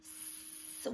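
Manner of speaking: whispered hissing S, no voice; isolated s sound. Shown as a demonstration. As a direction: hiss the S sound softly. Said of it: "What do you hear at the beginning of soap".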